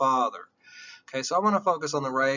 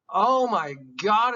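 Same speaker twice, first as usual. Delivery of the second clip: louder and slow, amazed; awestruck; 5 semitones higher, 5 dB louder than usual. Real, not acted. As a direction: let the wonder hit you. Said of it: "is that crazy?".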